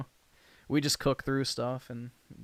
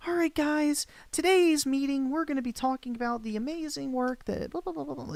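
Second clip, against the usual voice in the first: much higher, put-on chirpy corporate voice; mock meeting-leader voice; 13 semitones higher than usual. Mocking a coworker kicking off a work meeting, high and hammed-up. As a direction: ham up a cheery boss opening a team meeting. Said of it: "I'm like".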